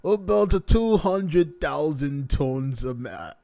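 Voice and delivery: playful put-on Russian accent. Shown as a demonstration. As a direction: drag out each word in a hammed-up Russian accent. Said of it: "let me try this in a Russian accent".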